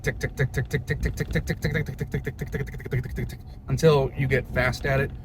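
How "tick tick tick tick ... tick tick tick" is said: rapid ticking. Shown as a demonstration. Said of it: "let's go ahead and practice saying tick".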